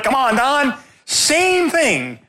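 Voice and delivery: high, insistent nudge to a tongue-tied friend; urging friend. Retold or imitated. Urging his silent friend.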